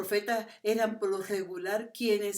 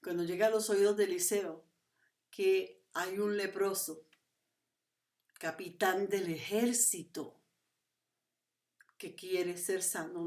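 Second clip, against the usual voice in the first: normal talking voice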